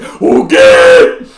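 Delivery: high, loud, stretched whale cry; shouted whale noise; whale impression. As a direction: call it out like a whale. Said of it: "That's my whale impression".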